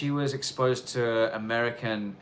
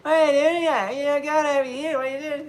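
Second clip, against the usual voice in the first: much higher, loud slurred Aussie voice; mock a slurring Aussie local; slurry Aussie slang; 6 dB louder than usual. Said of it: "the slurry Aussie slang".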